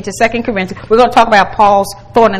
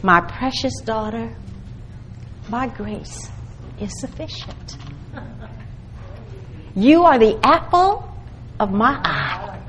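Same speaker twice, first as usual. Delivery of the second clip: soft, hushed and slow, like a loving father; God whispering comfort to his precious daughter, very soft; tender voice of God; 9 dB quieter, slower than usual. Acted. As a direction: speak each line gently to a beloved daughter. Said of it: "let me put it this way".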